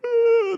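a loud, high, heavy sigh of defeat; one big heaving sigh. Acted. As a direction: sigh like all hope is gone. Child's world falls apart.

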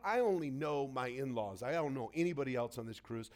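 ordinary talk

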